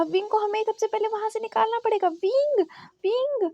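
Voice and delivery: high, pressing voice rising into calls; insist, then call the name out; urgent calling out. Acted. Venling wanting Wing pulled out of the crowd, high urgent calling.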